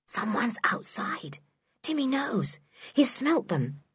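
urgent, hushed warning; secretive whisper, barely voiced. A narrator's reading. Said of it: "and whispered".

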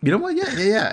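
high eager yes. Retold or imitated. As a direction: jump at the offer, pitch way up. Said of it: "I was like".

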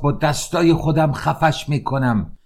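angry threat. A narrator's reading. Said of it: "ناراحت و عصبانی بشه".

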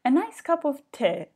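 light English accent on tea; British accent. Shown as a demonstration. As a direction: say it like a Londoner. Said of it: "спробую це з британським акцентом сказати".

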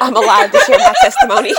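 high, loud, laugh-filled squeal; laughing hard. Real, not acted. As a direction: squeal it through your laughter. Joking about the near car crash.

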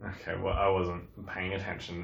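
ordinary talk, nothing marked

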